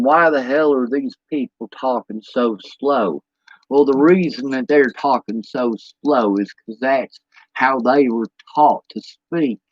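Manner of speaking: slow, low, drawn-out delivery; slowed Southern talk. Shown as a demonstration. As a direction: draw the words out, pitched low. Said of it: "you have to talk slower".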